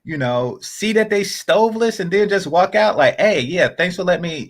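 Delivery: ordinary delivery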